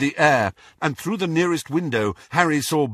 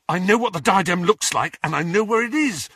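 urgent, quicker words; talking fast; 7 semitones higher, faster than usual. A narrator's reading. Harry urgently telling friends where the diadem is, fast. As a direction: rattle it off in a hurry. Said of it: "talking fast".

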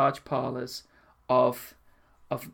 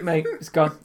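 normal talking voice

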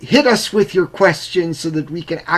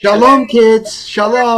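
loud farewell; 6 semitones higher, 9 dB louder, slower than usual. Real, not acted. Loud farewell to departing guests.

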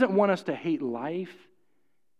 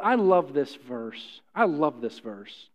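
tender, slow; 6 semitones lower, slower than usual. Real, not acted. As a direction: linger on it gently, like something dear. Tender pause before reading scripture.

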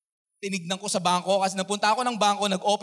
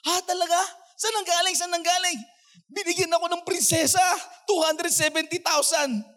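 excited; 11 semitones higher than usual. Retold or imitated. Joyful phone exchange retold.

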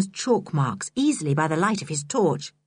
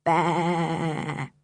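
a long low sheep bleat; bleating baa; 3 semitones lower, 3 dB quieter than usual. A narrator's reading. Julian mimics sheep in the dark cave, long bleat. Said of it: "long bleating".

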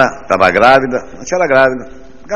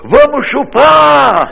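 very high, loud, stretched-out yell; rowdy shout; 7 dB louder, much slower than usual. Acted. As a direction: bellow it from the back of the crowd. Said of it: "Um filho da puta gritou".

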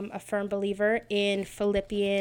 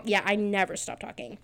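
ordinary talk, nothing marked

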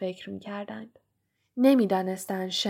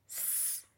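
shush; 23 dB quieter than usual. Acted. Hushing Ron in dark corridor.

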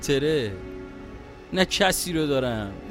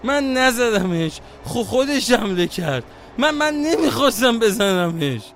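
stuttering, louder, frantic denial; panicked stammer; 4 dB louder than usual. Acted. Panicked after stabbing. (با لکنت و وحشت)

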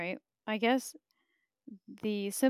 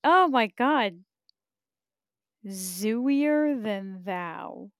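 louder, slow, stunned oh my God; shocked exclamation; 9 dB louder, much slower than usual. Real, not acted. Finding the podcast is real, loud stunned disbelief. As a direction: draw it out in disbelief.

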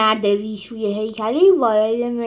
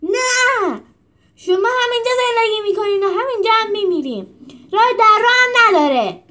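much higher, louder furious bellow; roaring shout; 11 semitones higher, 8 dB louder than usual. A narrator's reading. Trainer leaning over Julius and roaring his refusal, high and loud. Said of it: "هوار زد".

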